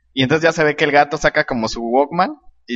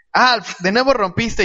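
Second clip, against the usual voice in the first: lightly acted scolding, higher and slow; higher quoted complaint; comic-strip Willy; 8 semitones higher, slower than usual. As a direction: draw out a mild mock scolding of Alf. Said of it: "y dice".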